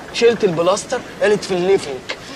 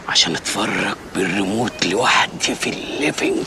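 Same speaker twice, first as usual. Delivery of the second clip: much lower, slower, softer punchline; deep deadpan; 12 semitones lower, 5 dB quieter than usual. Acted. Man revealing he just wants the remote, low deadpan. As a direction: drop the voice low for the joke.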